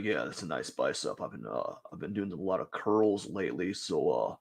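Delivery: low, soft bragging gym bro; macho gym guy. Acted. Voicing the bicep-pose model bragging to the ladies, low and smug. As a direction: murmur it like a smug guy showing off. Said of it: "He's like".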